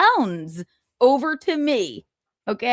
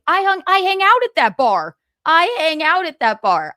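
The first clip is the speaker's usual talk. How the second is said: high, louder protest, hammering it out; indignant retort; 7 semitones higher, 4 dB louder than usual. Retold or imitated. Answering a comedian who questions why she stayed, indignant. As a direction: protest your right to be there, pitched up.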